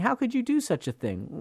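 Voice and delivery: high-pitched, indignant question; indignant reproach. Acted. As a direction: voice the onlookers' disbelief. Voices outraged onlookers at hypocrite.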